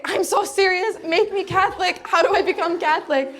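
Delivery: high, urgent convert's begging; eager insistent plea. Acted. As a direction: plead with over-the-top eagerness. Acted eager plea to friend.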